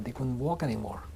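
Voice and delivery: normal talking voice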